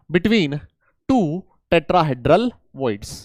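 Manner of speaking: very slow, each word spaced for the class; slow emphasis. Shown as a demonstration. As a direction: spell it out word by word, slowly. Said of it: "teacher stresses key definition slowly". (बहुत धीरे, हर शब्द पर ज़ोर देकर)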